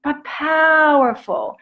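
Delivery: emphatic. Real, not acted. Emphatic climax.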